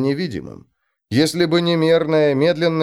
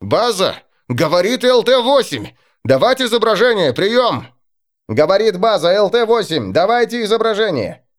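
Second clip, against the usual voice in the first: louder and higher radio calls; urgent radio call; 8 semitones higher, 4 dB louder than usual. Acted. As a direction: bark orders over the radio.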